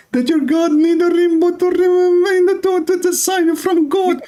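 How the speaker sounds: high-pitched, strained accusation; indignant tirade. Real, not acted. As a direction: throw the mockery back, pitch climbing high. Indignantly retelling opponent's mockery.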